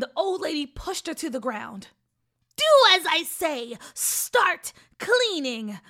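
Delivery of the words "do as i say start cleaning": angry command. Acted. Witch commands the girl.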